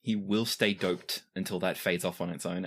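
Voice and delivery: normal talking voice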